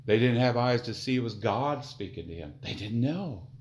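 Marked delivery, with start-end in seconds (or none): none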